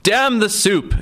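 high furious bellow. A narrator's reading. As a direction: roar it at the top of his voice. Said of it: "Anton bellowed".

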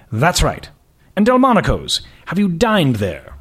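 put-on slow, lofty prep-school British accent; slow aristocratic accent. Boss questioning the candidate slowly in an aristocratic accent. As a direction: savour each word like a bored aristocrat. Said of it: "His accent was patently aristocratic".

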